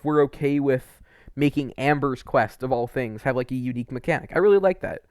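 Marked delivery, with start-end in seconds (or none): none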